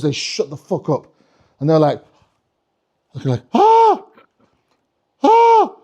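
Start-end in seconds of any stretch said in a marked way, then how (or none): 3.5-5.8 high-pitched, breath-caught "ah"; awestruck gasp. Acted. Cocky kids seeing Father Christmas, awestruck gasp. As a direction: gasp like a dumbstruck kid.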